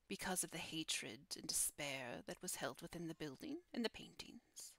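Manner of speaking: hushed, half-whispered guardian voice with long pauses; solemn guardian. Acted. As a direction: speak as a solemn keeper of dark stories. Guardian explains who claimed it.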